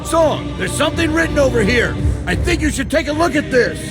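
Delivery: high-pitched call across the room. Acted. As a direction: call him over from a distance. Calling leader over.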